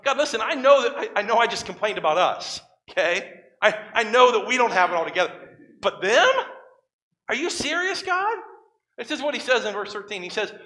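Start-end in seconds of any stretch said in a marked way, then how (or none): 0.0-8.4 louder, rising to a high disbelieving squeak; Habakkuk protesting. Acted. Habakkuk objecting to God, louder and climbing high in disbelief. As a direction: play Habakkuk aghast at the choice. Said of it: "Habakkuk's kinda going".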